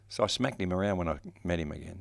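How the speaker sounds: ordinary talk, nothing marked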